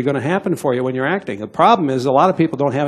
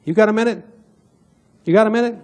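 casual ask; 7 semitones higher, faster than usual. Retold or imitated. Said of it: "then I ask them".

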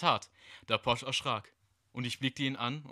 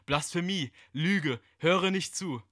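gedachter Schrei; 6 semitones higher, 5 dB louder, slower than usual. Acted. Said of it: "zu ihm hingehen und schreien".

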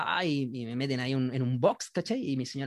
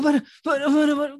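urgent plea; 9 semitones higher than usual. Retold or imitated. Drunk begs to stop car.

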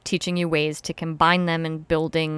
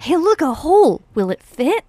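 higher, louder silly voice; silly mock voice; 9 semitones higher, 6 dB louder than usual. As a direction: play a dopey guy. Imitating a penis owner.

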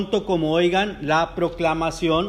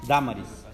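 DÁ-ma-ris, first syllable stressed, lower falling end; 4 semitones lower than usual. Shown as a demonstration. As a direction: say Dámaris with the accent on the opening DÁ. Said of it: "Acento en la A".

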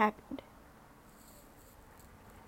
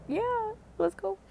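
eager yes; 9 semitones higher, slower than usual. Retold or imitated. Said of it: "And I was like".

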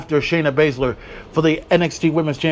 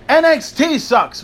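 mocking rant; 9 semitones higher, 3 dB louder, slower than usual. Real, not acted. Ranting list of promotions.

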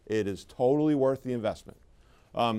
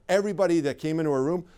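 normal talking voice

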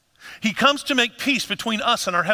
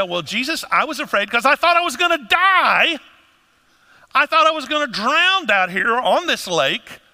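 higher, louder, protesting he was scared; defensive fearful plea; 5 semitones higher, 4 dB louder than usual. Retold or imitated. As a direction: justify your fear, worked up. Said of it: "I would say".

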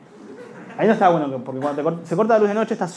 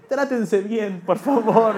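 pleading voice; 4 semitones higher, much slower than usual. Acted. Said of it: "la Ivana Nadal de las voces".

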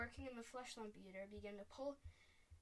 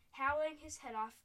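plain reading voice

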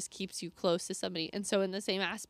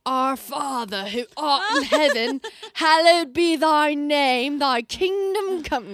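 put-on slow, lofty British accent, much higher and louder; 7 semitones higher, 10 dB louder, slower than usual. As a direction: recite the King James prayer in a grand British accent. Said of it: "You sound like you're coming from Harry Potter now".

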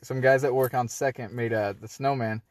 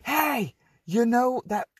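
high-pitched mock holler; imitated window yell; 12 semitones higher, slower than usual. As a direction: call out the window, imitating her. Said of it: "yelled out the window".

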